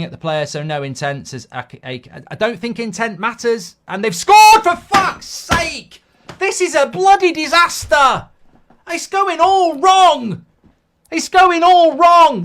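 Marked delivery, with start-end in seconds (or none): 3.9-12.4 loud, exasperated ranting; real anger at conceding, drawn out and loud; furious outburst. Real, not acted. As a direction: fan raging at the TV after the opposition scores. Fan's team concedes a goal.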